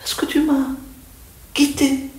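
very high, impassioned devotional plea; pleading recitation. Acted. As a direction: cry out to the absent beloved, voice lifted. Recites the poem's reproach to the beloved. (supplication ardente, voix haut perchée)

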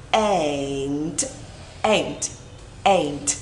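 low, drawn-out "Ain't" with "ei" glide; correct pronunciation. Shown as a demonstration. Teacher drills learners, low "Ain't" with full glide. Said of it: "Âm này là âm ain't".

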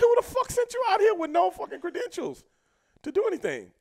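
high, loud mock indignation; incredulous outburst. Veteran challenging the rookie's credentials, high loud indignation.

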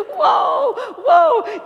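loud, high calming-down whoa; startled whoa. Retold or imitated. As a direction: cut in over the panic, high and loud. Her stunned reply to panicked caller.

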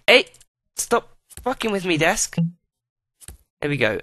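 ordinary delivery

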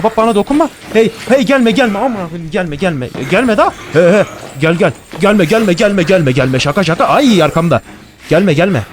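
high, loud panicky pleas to stay away; panicked yelling. Real, not acted. Gamer chased by a monster, yelling in real panic. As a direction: yell at the monster to back off, voice up high.

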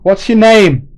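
very high, loud desperate call; screaming down the road. Acted. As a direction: scream it after him as he goes. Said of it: "he screamed down the road".

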